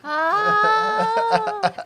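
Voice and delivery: long, high, loud "oh" as it clicks; delighted realization. Real, not acted. Cohost finally gets the pun, loudly amazed. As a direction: let out a big high cry of sudden understanding.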